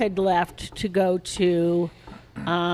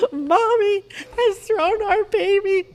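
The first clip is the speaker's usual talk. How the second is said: put-on screaming little girl; 14 semitones higher, 7 dB louder than usual. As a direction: imitate a three-year-old shrieking accusations. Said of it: "So she started screaming".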